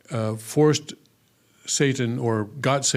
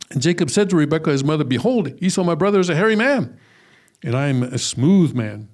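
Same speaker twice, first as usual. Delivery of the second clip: brisk, raised reading of Jacob's protest; scripture reading; 3 semitones higher than usual. Acted. Teacher reading Jacob's worry to the class, lightly heightened scripture reading. Reads Jacob's words aloud.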